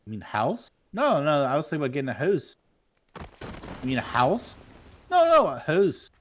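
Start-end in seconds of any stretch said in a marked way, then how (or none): 0.9-2.6 Canadian voice saying "house" as hoose; quick joking Canadian reply; mock Canadian accent. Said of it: "they say hoose". 5.1-6.1 higher, insistent Canadian "hoose"; exaggerated Canadian protest; mock Canadian accent. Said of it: "they say hoose".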